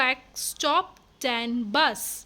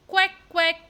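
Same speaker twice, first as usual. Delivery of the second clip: duck quacks; 5 semitones higher, 5 dB louder than usual. Acted.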